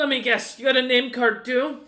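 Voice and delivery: high, loud taunt; mocking guard. Guard jeers at James's credentials, loud mockery. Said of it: "He looks up at you and goes".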